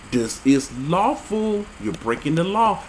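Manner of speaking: ordinary talk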